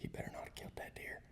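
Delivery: quick, hushed whisper; anxious whisper. Retold or imitated. To himself about his buddy's hunt, fast whisper. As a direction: fret under your breath.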